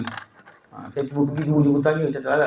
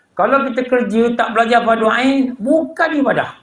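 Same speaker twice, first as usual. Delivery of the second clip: raised pitch, insistent sermon delivery; emphatic preaching; 6 semitones higher, 3 dB louder than usual. Acted. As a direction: press the warning home to the congregation. Preaches warning about work.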